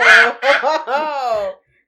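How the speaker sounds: real burst of loud laughing; loud laughter. Real, not acted. Player reacts to awkward scene.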